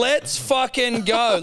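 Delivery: high, loud and drawn out; hyped-up cheer. Retold or imitated. Recalling his answer to the hair comments, slow loud hype. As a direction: stretch it out like a hype call. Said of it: "I said".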